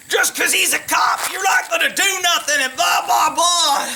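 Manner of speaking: hammed-up retold yelling at the police; yelling at cops. Neighbors yelling at the cops, mock yell. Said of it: "they start yelling at the cops".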